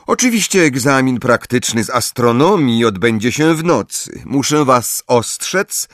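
formal, emphatic schoolmistress; stern professor. Acted. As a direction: announce exam rules to a class, measured and strict. Professor addresses her class.

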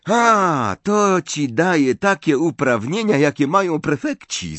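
high, loud, bursting with joy; delighted exclamation. A narrator's reading. Hermione congratulating Harry on being captain, overjoyed. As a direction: call it out, thrilled for him. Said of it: "zawołała uradowana Hermiona".

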